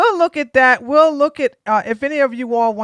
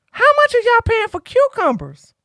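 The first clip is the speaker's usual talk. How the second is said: much higher, teasing voice; mock squeaky question; 12 semitones higher than usual. Streamer teases chatters about cucumber prices in a much higher voice.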